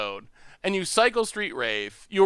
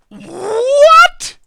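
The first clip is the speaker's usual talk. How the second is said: loud, high-pitched disbelieving yell; incredulous shout, far above normal pitch; shocked outburst; 15 dB louder than usual. Real, not acted. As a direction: let it swoop way up into a loud, baffled cry. Baffled outburst while reading a question.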